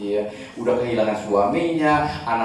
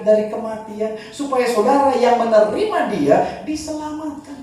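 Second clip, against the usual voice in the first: much higher, fervent sermon voice; preaching at full force; 12 semitones higher, 3 dB louder than usual. Acted. Preacher warning congregation about empty riches, fervent high preaching. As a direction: drive the point home to the crowd.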